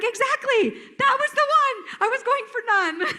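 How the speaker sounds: high, delighted outburst through laughter; laughing exclamation. Retold or imitated. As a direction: squeal it out while laughing. Laughing retort about her silly accent.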